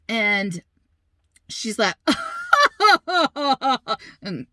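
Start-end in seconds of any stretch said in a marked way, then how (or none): 2.0-4.0 high mock cackle; imitated mocking laugh. Stranger laughing at a worried mother, imitated mocking laugh. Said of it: "you know, laughing".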